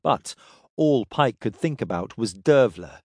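plain reading voice

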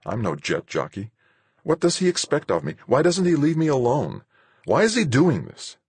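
high, strained, pleading; desperate protest. Acted. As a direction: voice climbing in despair. Van desperate, overwhelmed while flying.

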